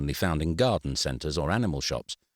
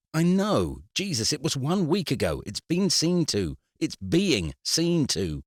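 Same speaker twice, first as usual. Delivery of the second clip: exasperated outburst; 9 semitones higher than usual. A narrator's reading. Eric losing patience with the solicitor, high exasperated snap. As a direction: burst out, fed up and defensive.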